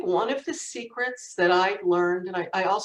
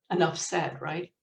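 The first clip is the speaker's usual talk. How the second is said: normal talking voice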